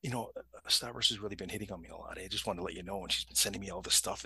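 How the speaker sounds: low, soft, private confession; confiding. Retold or imitated. Woman confiding in a supervisor about harassment, low and confiding. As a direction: confide it quietly in a trusted colleague. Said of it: "she confides in him".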